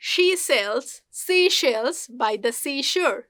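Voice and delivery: very slow "She sells", SH and S kept distinct; careful, spaced-out model of "seashore"; S and SH drill. Shown as a demonstration. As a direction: separate the S and SH in "seashells". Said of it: "you will improve your sa".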